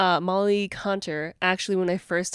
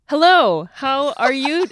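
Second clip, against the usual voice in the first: slow pointed greeting; 6 semitones higher, 8 dB louder, slower than usual. Retold or imitated. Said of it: "then she goes".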